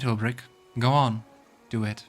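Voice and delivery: ordinary talk, nothing marked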